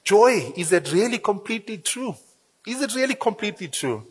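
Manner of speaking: dry, mocking doubt on joy; sarcastic. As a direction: throw the word joy back with irony. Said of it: "And I'm being sarcastic when I say that".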